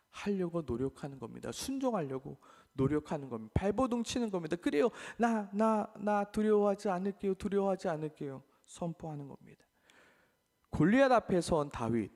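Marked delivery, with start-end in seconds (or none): 4.6-8.4 stammering, a bit higher vow; trembling resolve. Acted. Believer answering God, halting higher vow. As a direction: push through fear to promise. Believer declaring despite fear.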